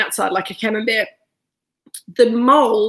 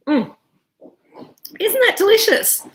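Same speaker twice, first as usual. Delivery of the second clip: delighted; 11 semitones higher than usual. Real, not acted. Savouring after a sip of wine.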